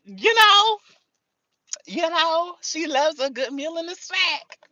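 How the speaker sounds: high mock voice of a food-loving girl; mock girly voice. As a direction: play a high, coy girl defending her snacks. Voicing a girl who loves food.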